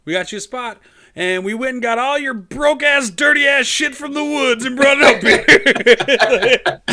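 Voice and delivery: rising into a loud laughing yell; laughing mock shout. Friend voicing Sonic's new parents, laughing shout. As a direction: bellow it while cracking up.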